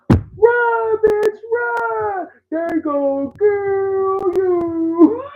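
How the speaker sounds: very high, loud warning scream; screaming inner victim. Acted. Inner stalking victim warning interviewees, screaming. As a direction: shriek it, stretching the last words out long. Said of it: "inner organized stalking victim wants to tell you".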